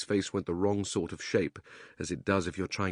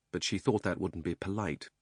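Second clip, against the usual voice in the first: ordinary delivery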